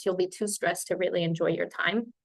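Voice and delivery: normal talking voice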